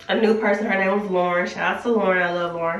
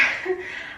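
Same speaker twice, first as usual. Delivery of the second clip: teary laugh; 9 semitones higher, 9 dB quieter than usual. Real, not acted. Laughing at herself tearing up.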